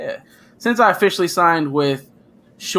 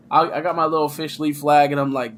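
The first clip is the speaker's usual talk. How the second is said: ordinary talk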